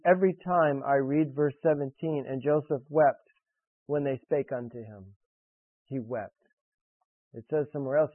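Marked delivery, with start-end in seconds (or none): 2.3-6.3 moved, hushed. Real, not acted. Preacher moved by Joseph weeping, hushed and tender. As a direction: voice dropping soft and low with feeling. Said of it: "This affects me more".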